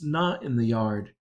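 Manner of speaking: ordinary delivery, nothing marked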